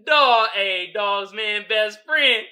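loud, high, slow emphatic lines; excited drawn-out. Real, not acted. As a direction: savour the joke slowly. Excited repeat about dog.

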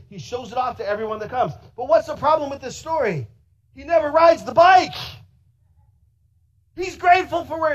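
shouted preaching. Acted. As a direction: hammer the point home at full voice. Preaching at full force.

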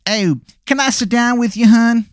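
put-on high, terrible Baltimore accent; hammy Baltimore hun voice. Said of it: "the worst Baltimore accent that you have ever heard".

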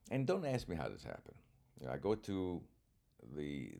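ordinary talk, nothing marked

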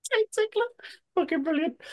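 very high, squeezed laughing voice; cracking up. Real, not acted. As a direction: repeat it helpless with real laughter. Cracking up at a listener's insult.

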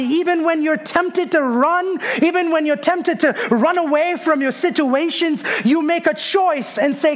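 high, driving sermon delivery; impassioned preaching. Real, not acted. Preacher urging congregation to have faith in darkness, high impassioned preaching.

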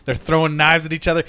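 ordinary talk, nothing marked

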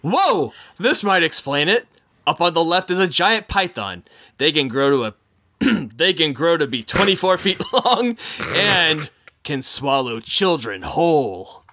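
upbeat tour guide patter; boat skipper spiel. Acted. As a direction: sell the jokes like a jungle skipper. Performs skipper spiel with jokes.

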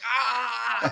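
high crying yelp; mock sob-cry of the kid; crying wail, pitched high; imitated bawl. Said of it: "bawling tears".

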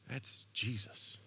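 slow, breathless whisper of recognition; hushed whisper. Acted. John whispers to Peter on seeing Jesus, hushed. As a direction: let the name slip out in a whisper.

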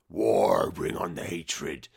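very low, slow hammed-up villain call to war; mock war cry. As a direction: boom it out low and slow like a cartoon warlord.

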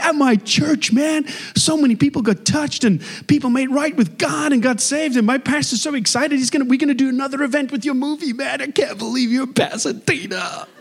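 high, gushing American fan rush; excitable bank teller. Acted. Teller telling the filmmaker about his church, gushing excitedly. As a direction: rave on like a starstruck fan. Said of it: "there's this excitable, um, teller".

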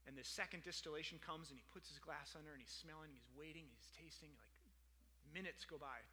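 low, soft storytelling; hushed suspense. Retold or imitated. Preacher retelling the long wait for the first whiskey, hushed. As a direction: draw the listeners into the wait, softly.

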